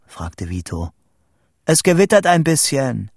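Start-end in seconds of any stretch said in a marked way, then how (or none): 1.7-3.1 high, raised reply; calling down. A narrator's reading. Boy reporting the storm to Vito, calling down. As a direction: call the news down. Calls down to friend.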